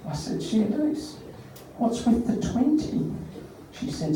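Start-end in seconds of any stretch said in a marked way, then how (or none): 0.4-3.1 hushed, slightly raised voice; wary question. Retold or imitated. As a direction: ask very softly, as if dreading the answer. Acted wary question, expecting nonsense.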